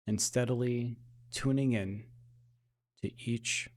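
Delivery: hushed, even meditation voice with long pauses; gently steer attention into the body, unhurried; guided meditation. Acted. Guide bringing listeners back to normal breathing, soft guided meditation.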